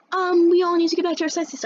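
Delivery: high-pitched teacher scolding the class; mimicked teacher. Teacher telling everyone to get back to their senses, mimicked high voice. Teacher interrupting, acted in story.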